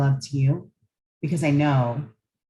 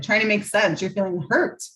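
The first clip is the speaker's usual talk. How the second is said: ordinary talk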